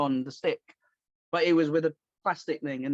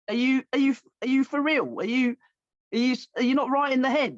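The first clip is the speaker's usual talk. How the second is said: indignant; 8 semitones higher, 3 dB louder than usual. Retold or imitated. Confronting bullies' parents.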